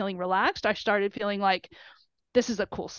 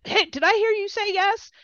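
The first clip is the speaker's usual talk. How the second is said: high-pitched imitation of thrilled coaches; excited coaches; 10 semitones higher than usual. Coaches congratulating a new recruit, retold with excitement. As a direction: call it out high, eager to hear the yes. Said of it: "everybody was super excited".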